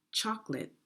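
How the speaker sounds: usual speaking voice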